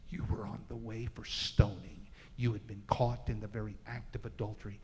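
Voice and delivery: forgive her gently, almost a whisper; tender Jesus. Acted. Jesus speaking tenderly to woman.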